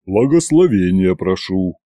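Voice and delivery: deep, low bass plea; Иван. A narrator's reading. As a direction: ask for a blessing in a deep bass. Иван басом просит благословения. (просьба низким басом)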